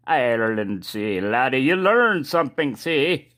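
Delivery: a deliberately bad Irish accent, brogue put on; jokey fake Irish accent showing off a knife from Ireland. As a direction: ham up a cheesy Irish accent, laddie-style. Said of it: "pretty bad Irish accent there".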